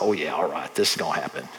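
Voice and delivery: low, dry, unconvinced mutter; mock disbelief, low and deadpan; sarcastic doubt. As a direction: shrug it off as if it never will. Pastor mocking his own doubt.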